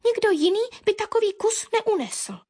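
high, halting little bird; hesitant sparrow. A narrator's reading. Sparrow argues back to Baron, high and hesitant. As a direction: offer it uncertainly, with a gap. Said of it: "poposkočil nerozhodně vrabčák".

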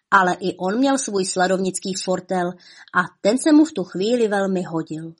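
ordinary delivery

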